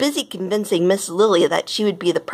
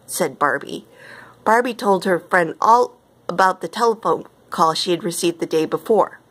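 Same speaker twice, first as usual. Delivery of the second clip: plain reading voice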